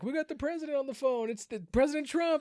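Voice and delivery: high excited host voice; excited announcer. Retold or imitated. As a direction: announce the big guest with pride. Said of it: "He's like".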